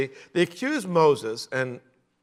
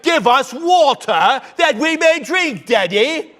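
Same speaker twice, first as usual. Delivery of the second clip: mock bratty child; 8 semitones higher, 6 dB louder than usual. Israelites voiced as spoiled child.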